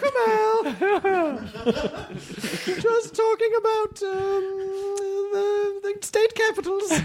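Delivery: put-on very high, laughing old lady covering up; high prim old lady changing the subject. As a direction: chirp it sweetly high like a flustered grandmother. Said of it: "turned her into an old lady now".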